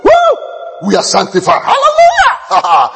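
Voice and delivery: high whooping praise, hammed up; hammed-up high cry of hallelujah; mock excited preacher. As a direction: ham up a joyful believer shouting woo. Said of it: "when they are preaching".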